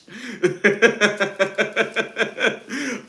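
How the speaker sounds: loud high-pitched cackle. Real, not acted. Laughing at her own insult, high cackle.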